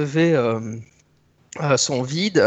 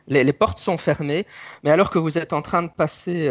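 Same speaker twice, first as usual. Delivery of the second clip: ordinary talk, nothing marked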